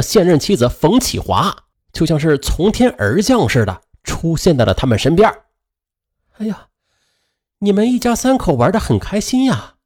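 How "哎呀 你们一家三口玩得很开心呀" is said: high, sneering mock cheer; sarcastic. A narrator's reading. Wife catching husband out, sneering high. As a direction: catch him out with fake sweetness.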